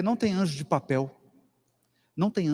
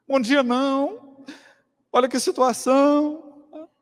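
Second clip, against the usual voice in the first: higher, louder, a hammed-up wail; mock whiny complaint; 9 semitones higher, 4 dB louder than usual. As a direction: play the self-pitying patient moaning. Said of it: "chorando, triste, reclamando".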